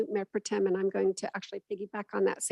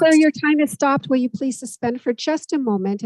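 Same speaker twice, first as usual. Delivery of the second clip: raised voice to interrupt; louder and higher, taking the floor; firm and loud; 4 semitones higher, 7 dB louder than usual. Real, not acted. Chair cutting off a speaker.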